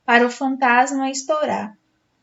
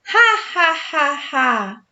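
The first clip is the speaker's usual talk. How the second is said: a wicked mocking cackle; ghostly evil cackle; evil laugh; 3 semitones higher, slower than usual. A narrator's reading. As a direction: cackle like a villain. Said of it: "Que risada malvada!".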